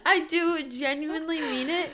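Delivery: high, loud, protesting through laughter; laughing. Real, not acted. Speaker defends her sincerity to cohost, laughing. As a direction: insist you mean it while cracking up.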